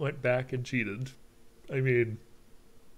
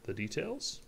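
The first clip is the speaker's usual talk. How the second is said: higher, rising query; puzzled prompt with a rise at the end; questioning echo; 5 semitones higher, 5 dB quieter than usual. Acted. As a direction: echo it back as a question, pitched up. Another character echoes as question.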